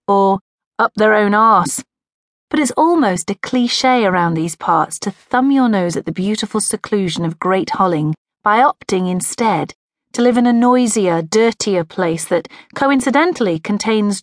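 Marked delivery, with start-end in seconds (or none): none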